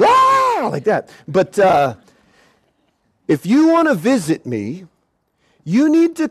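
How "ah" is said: high mock scream; repeated scream. Preacher showing how he kept screaming, high yelp. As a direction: keep yelping in fright. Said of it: "I kept on screaming".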